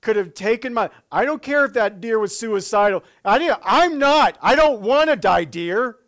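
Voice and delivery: high, loud rattled rant at the deer; mock-furious shaken driver; shaken outburst. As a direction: yell at the deer after the near miss. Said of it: "And you're like".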